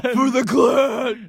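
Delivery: slow drawn-out battle shout; battle cry. Pretend. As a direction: stretch it out like a war cry. Battle cry, falling on clarinet.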